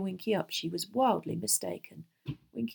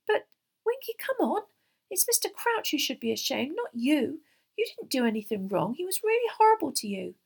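put-on higher earnest young girl; 9 semitones higher, 4 dB louder than usual. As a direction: coax her gently, girl's voice. Hermione pleading with elf.

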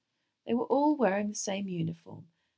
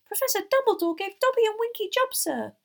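very high, loud house-elf voice; thrilled little elf at full pitch; excited squeal; 7 dB louder than usual. A narrator's reading. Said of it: "Dobby squealed excitedly".

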